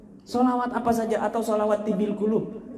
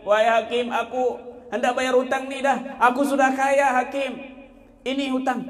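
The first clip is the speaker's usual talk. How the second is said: debtor's voice; 7 semitones higher than usual. Acted. Debtor speaks to the judge.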